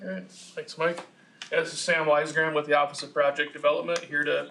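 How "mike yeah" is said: ordinary talk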